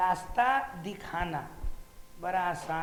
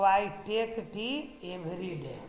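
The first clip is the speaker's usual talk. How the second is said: slow, word-by-word model sentence; spoken slowly like a teacher dictating; slow dictation; much slower than usual. Shown as a demonstration. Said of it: "तो".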